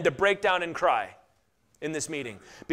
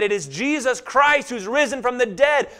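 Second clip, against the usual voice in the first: higher and louder, a bold declaration; Peter proclaiming; 6 semitones higher, 6 dB louder than usual. Acted. Peter to the crowd about the risen Christ, loud and bold. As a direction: announce it so the temple hears. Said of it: "He said".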